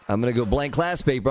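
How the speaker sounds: ordinary delivery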